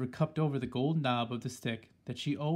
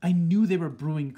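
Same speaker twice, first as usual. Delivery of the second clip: grandmother shouts louder and higher in sudden triumph; excited shout; 4 semitones higher, 9 dB louder than usual. A narrator's reading. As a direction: leap up shouting that you knew it. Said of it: "leapt out of her chair shouting".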